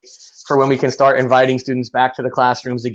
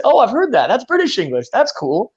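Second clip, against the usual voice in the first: bright higher, easygoing reaction; mock cheerful reply, higher; light friendly voice; 8 semitones higher than usual. Shown as a demonstration. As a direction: act pleasantly surprised, pitched up. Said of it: "just say".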